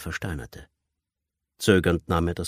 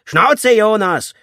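high, loud scream to shut up; explosive ringleader's yell; furious shout; 11 dB louder, much slower than usual. A narrator's reading. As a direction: shout the captive down. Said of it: "schrie der Anführer".

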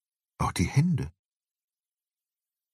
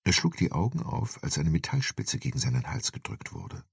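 ordinary delivery

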